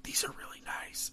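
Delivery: mock polite whisper. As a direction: whisper a compliment. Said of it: "like, whispering".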